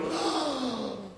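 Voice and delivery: a soft, breathy gasp of shock; mock gasp. Pastor acting the shocked room for children, soft mock gasp. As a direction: gasp as if hearing something awful. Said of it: "They go".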